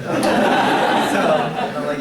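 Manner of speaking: ordinary talk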